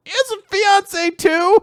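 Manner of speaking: very high, incredulous question; astonished dad. Retold or imitated. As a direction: squeak it out in disbelief. Dad shocked by fiancée news.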